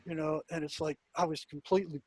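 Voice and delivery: ordinary talk, nothing marked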